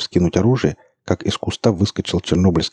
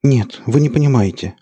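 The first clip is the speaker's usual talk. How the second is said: babbling; 7 semitones higher, 3 dB louder than usual. A narrator's reading. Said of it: "лепетал эльф".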